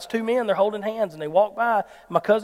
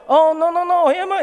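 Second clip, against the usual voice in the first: imitating panic; 8 semitones higher, 6 dB louder than usual. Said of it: "he thinks, "We're fixing to die".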